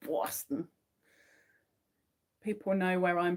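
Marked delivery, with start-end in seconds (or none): 0.1-0.7 softer Bostonian "Boston"; Bostonian accent. Acted. Practising Bostonian for the chat, soft Bostonian accent. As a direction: try "Boston" quietly like a local. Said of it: "Bostonian accent".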